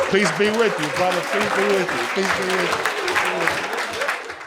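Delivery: high sing-song church greeting, slowing at the end; mock churchgoer. Comic mocking church greetings, high sing-song voice. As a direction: ham up a cheery churchgoer.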